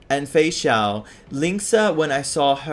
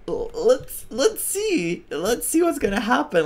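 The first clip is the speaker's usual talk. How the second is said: high squeaky eager voice; squeaky excited; 11 semitones higher than usual. Real, not acted. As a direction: squeak it, barely containing excitement.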